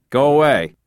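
loud, sharp order to leave; shouting. Acted. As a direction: bark it like a fed-up traffic cop. Traffic cop turning drivers away.

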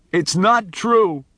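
high, slow tearful denial; crying out. A narrator's reading. Boy Miles denying it to Charlie in slow tears. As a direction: cry it out in denial. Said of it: "he cried".

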